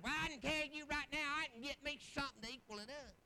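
put-on voice of a feisty wife; mock wife voice. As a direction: imitate her sassy comeback, playfully exaggerated. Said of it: "I'm gonna talk like that".

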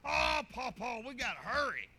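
put-on loud, eager child's plea, pitch up; excited begging child. Grandson begs Papa to hurry on the gator, loud eager plea. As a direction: beg Papa to hurry like an impatient kid.

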